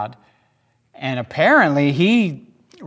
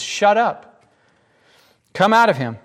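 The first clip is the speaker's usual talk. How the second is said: raised, commanding rebuke; ordering; 6 semitones higher, 3 dB louder than usual. Acted. Jesus orders the demon out of the man, commanding. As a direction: order it out with authority. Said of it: "he ordered".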